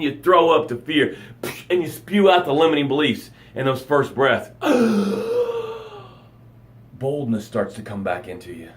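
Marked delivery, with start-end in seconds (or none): 4.6-5.8 sharp high gasp for air; revival gasp. Pretend. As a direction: gasp like breaking the surface. Revived from drowning, first breath.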